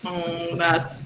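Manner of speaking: usual speaking voice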